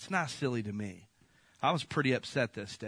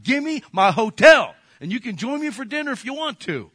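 fired-up demand; 10 semitones higher, 11 dB louder than usual. Retold or imitated. Preacher demanding his hotel from the manager, loud and defiant. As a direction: hammer the demand home. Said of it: "I said".

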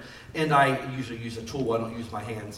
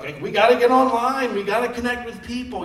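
loud, high, mock-frantic urging; raised-voice parody of a panicked rally; mock urgent cry; 8 semitones higher, 8 dB louder than usual. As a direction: imitate people suddenly scrambling in alarm. Mimics pastors suddenly urging online church.

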